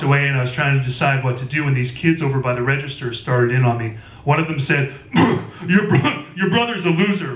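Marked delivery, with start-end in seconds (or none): 5.0-7.3 fake cough insult. A narrator's reading. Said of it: "really loud in a fake cough".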